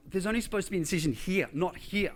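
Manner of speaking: mildly replayed panic from the hospital bed; panicked protest. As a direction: rattle it off in alarm. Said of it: "I'm like".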